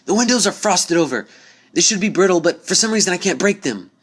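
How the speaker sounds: high, loud call from above. A narrator's reading. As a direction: shout down from the dark ceiling. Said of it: "called out from the darkness above".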